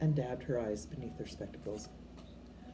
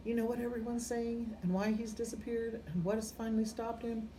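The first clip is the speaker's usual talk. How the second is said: put-on higher, tense stern old witch asking; anxious McGonagall; 8 semitones higher than usual. McGonagall pressing Dumbledore for the truth, higher anxious voice. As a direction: press him, anxious and high.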